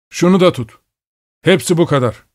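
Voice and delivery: high-pitched calling voice; uzaktan seslenme. A narrator's reading. Man calls while throwing bread. (tiz perdeden uzağa sesleniş)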